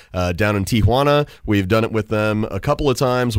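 normal talking voice

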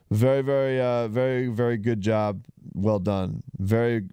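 tripping over his words, repeating; stumbling stutter on every word. Real, not acted. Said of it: "I'm stuttering here".

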